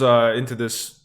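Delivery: normal talking voice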